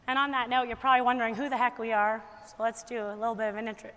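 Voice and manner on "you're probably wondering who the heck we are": ordinary delivery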